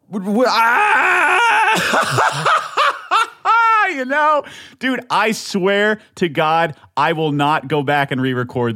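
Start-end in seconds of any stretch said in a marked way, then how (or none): 0.1-2.6 very high, long stretched shriek; unhinged yell. Real, not acted. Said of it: "how fucking nuts I sound". 2.6-3.9 very high, loud cackling laugh; wild high laugh after a scream; manic laugh. Real, not acted. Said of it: "I'm laughing because of how fucking nuts I sound".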